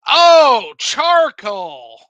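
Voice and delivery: loud surprised oh; sudden realization. Retold or imitated. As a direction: it finally clicks, blurt it. Sudden realization in retold scene.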